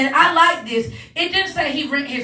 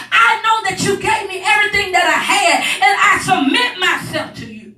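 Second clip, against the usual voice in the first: raised, loud, driving sermon cadence; higher and louder gospel preaching; preaching at full force; 6 semitones higher, 3 dB louder than usual. Acted. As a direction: preach it, building the fire.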